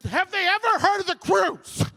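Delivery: high, loud exasperated yell; annoyed. Retold or imitated. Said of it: "starting to get so annoyed".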